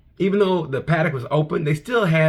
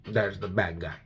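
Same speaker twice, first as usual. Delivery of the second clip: Scarface; 5 semitones lower, 5 dB quieter than usual. Retold or imitated. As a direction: drop it soft and low like a crime boss. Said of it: "Marc Marquez is a Scarface of Moto G P".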